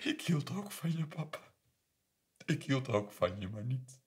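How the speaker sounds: high, soft, wounded voice; hurt confession. Retold or imitated. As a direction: let it drop after the shouting. Daughter's hurt reply, acted. (zacht, hoog en gekwetst)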